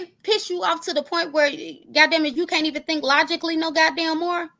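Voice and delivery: usual speaking voice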